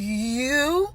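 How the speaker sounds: high, drawn-out mock disbelief; incredulous retold question, slow and high; shocked. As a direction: imitate a stunned admirer, voice rising into the question. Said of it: "They be so shocked".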